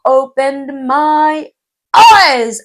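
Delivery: drawn out, building to a loud high peak; loud, dramatic revelation; amazed emphasis. Real, not acted. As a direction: stretch it out in amazement. Dramatizing how revealing the book was.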